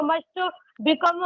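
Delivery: usual speaking voice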